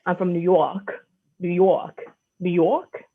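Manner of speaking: mock New York accent, repeated insistently; hammed-up phony New York accent; bad New York accent. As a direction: imitate a Brit faking New York. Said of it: "or they're like".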